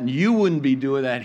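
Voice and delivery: ordinary talk, nothing marked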